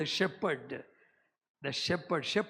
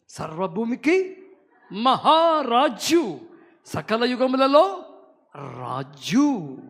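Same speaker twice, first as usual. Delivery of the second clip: loud, slow, drawn-out proclamation; full-force preaching; 5 semitones higher, 7 dB louder, much slower than usual. Acted. Pastor to congregation, proclaiming God's kingship in loud slow preaching. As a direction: call it out over the hall, stretching each word.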